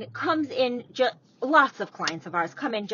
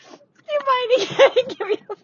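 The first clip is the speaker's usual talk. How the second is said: laughing; 13 semitones higher, 8 dB louder, faster than usual. Real, not acted. Repeating the joke, laughing.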